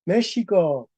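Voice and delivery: "México" dicho "Méshico", con sh y final alargado; x como sh. Shown as a demonstration. ("México" a la antigua, con sonido sh)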